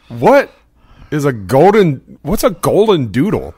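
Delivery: confused, high-pitched question; what on earth is that; baffled question. Retold or imitated. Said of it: "And I said".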